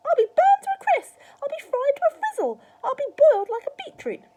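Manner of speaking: high, loud wailing tirade; yelled. A narrator's reading. Grandma fearing she will burn up, yelling. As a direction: screech each disaster at full volume. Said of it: "she yelled".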